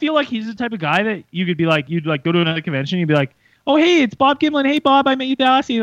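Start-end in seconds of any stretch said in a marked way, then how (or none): 3.7-5.8 eager greeting. Pretend. Imagined greeting at a convention.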